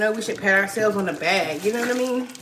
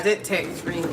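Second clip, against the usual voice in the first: ordinary delivery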